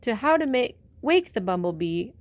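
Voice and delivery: put-on explaining like a wise old groundhog; a lightly voiced groundhog giving advice. A narrator's reading. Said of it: "he said".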